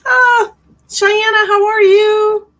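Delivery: high, loud, drawn-out hello; excited greeting. Real, not acted. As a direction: greet a favourite visitor with delight. Greeting a viewer who joins.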